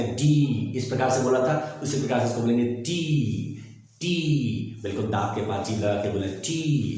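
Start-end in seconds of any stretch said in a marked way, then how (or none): 2.6-4.8 टी with a puff, long ee; drawn-out, aspirated टी; between T and TH. Shown as a demonstration. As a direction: aspirate the t of टी and hold the vowel. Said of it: "टा और ठा के बीच वाला sound".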